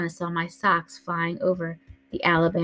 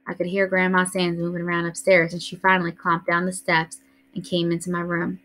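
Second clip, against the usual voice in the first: ordinary delivery, nothing marked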